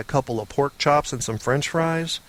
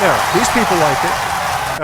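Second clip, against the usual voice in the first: mock crowd hype; 5 semitones higher, 5 dB louder than usual.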